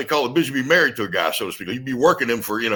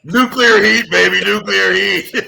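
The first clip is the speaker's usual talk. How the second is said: much higher, much louder laughing roar of a catchphrase; catchphrase shout; 9 semitones higher, 11 dB louder, slower than usual. Pretend. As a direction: bellow it like a wrestler hyping the crowd. Wrestler shouts his catchphrase.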